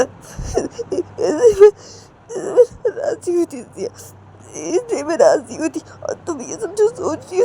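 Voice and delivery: high tearful sobbing plea; weeping, voice cracking; crying. Acted. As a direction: explain through breaking sobs. Ritu keeps crying throughout.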